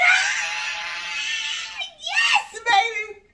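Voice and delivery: high, loud screaming delight; scream, then cheer it out, hamming up the thrill; re-enacted excitement. Mom re-enacting seeing the gift card, excited scream. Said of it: "I was so excited".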